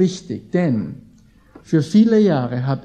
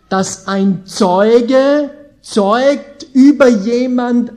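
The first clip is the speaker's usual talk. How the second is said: slow, higher and louder; emphatic point; 5 semitones higher, 5 dB louder, slower than usual. Acted. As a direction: stress zeugt über jemand.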